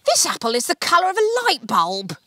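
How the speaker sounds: high, loud slick tycoon; Tarquin voice. A narrator's reading. Tarquin shows off the apple to Archie, high and loud. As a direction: show off the apple like a salesman. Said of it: "said Tarquin McCrankadell the third".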